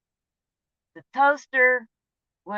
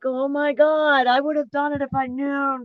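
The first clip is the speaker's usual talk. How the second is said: shocked exclamation; 7 semitones higher, 9 dB louder than usual. Retold or imitated. Everyone turns to stare at her, shocked and pleading. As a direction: gasp it out in disbelief. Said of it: "and I'm like".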